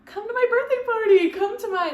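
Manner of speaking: inviting mom. Retold or imitated. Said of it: "she always would be like".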